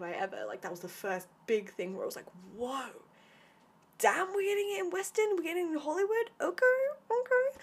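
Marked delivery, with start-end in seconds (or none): 2.6-7.6 high, loud, thrilled exclaiming; excited. Retold or imitated. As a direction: gush it out, much higher and louder. Excited reaction to representation.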